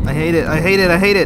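loud repeats rising in pitch; panicked protest. Real, not acted. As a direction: wail it over and over in fear. Frightened player, monster close behind.

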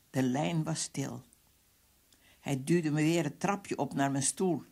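usual speaking voice

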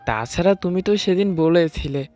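ordinary delivery

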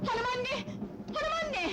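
very high, alarmed cry; alarmed calling. Acted. Daughter-in-law rouses fainted mother-in-law, alarmed high calling. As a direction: call urgently to rouse someone who fainted.

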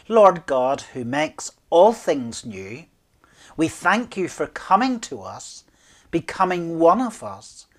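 fervent led prayer; spoken prayer. Acted. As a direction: pray aloud for the congregation.

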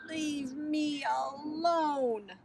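very slow, drawn-out sulk; sulky moan. A narrator's reading. Sister under the covers sends everyone off, moaning sulkily. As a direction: stretch each word into a moody moan.

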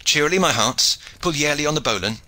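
high, loud, quickening call; calling orders. A narrator's reading. Shipmaster to his hearts on the bowline, calling out. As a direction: urge the crew on heartily.

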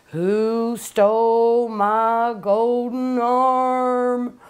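very slow, drawn-out eerie moan; spooky ghost-story voice. Retold or imitated. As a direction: stretch every word like a ghost in the dark. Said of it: "at the end she'd say".

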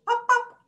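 high, loud pops; popping sounds. Acted. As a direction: burst out with two pops. Cocoon bursting open.

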